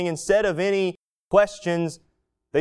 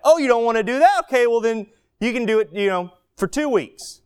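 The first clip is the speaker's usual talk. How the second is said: sharp teacher comeback; 5 semitones higher, 3 dB louder, faster than usual. Retold or imitated. Teacher catching student's thought.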